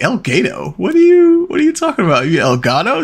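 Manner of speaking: can't believe what she just said; mock bewildered husband; incredulous. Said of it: "I was like".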